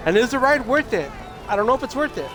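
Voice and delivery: normal talking voice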